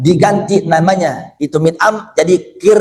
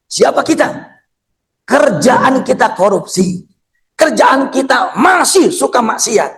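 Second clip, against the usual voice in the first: raised-pitch, emphatic admonishment; fervent, scolding sermon; preacher's rebuke; 7 semitones higher than usual. Real, not acted. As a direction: challenge the congregation with their own sins. Rebukes the congregation.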